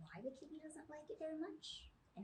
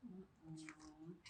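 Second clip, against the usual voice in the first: plain reading voice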